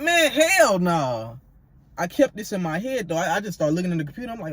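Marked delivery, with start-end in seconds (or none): 0.0-1.4 high, drawn-out disbelief; appalled retold refusal. Reacting to his roommate naked in front of him, appalled.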